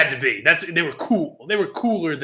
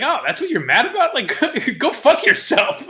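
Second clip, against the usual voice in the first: laughing retort; 7 semitones higher than usual. Retold or imitated. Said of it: "I was like".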